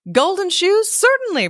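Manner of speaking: very high, indignant beetle voice; haughty beetle. A narrator's reading. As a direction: sniff it out as a proud little beetle. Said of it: "replied the beetle".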